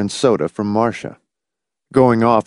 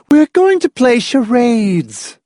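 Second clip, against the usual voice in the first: high, loud, drawn-out gleeful announcement; joyous; 5 dB louder, slower than usual. A narrator's reading. Hostess announcing party games, joyous. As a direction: sing it out with delight, high and loud. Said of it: "informed him joyously".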